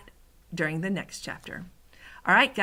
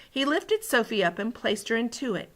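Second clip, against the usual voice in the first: ordinary delivery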